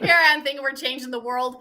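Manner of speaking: amused laugh running into the words; real giggle at herself. Real, not acted. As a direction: laugh at your own big hopes.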